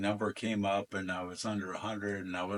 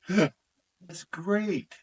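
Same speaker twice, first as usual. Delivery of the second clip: excited; 10 semitones higher, 8 dB louder than usual. Retold or imitated. Brother excitedly announces the draft.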